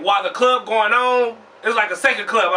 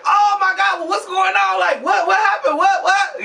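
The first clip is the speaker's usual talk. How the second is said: panicked shout; 10 semitones higher, slower than usual. Retold or imitated. Waking in the passenger seat after a crash, high panicked yelling. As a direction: shriek in confusion as you wake.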